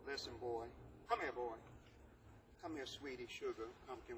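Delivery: lightly voiced mom, coaxing then sweet; imitating mothers. Teacher imitating a mom calling her son, lightly coaxing. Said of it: "mothers say this to you".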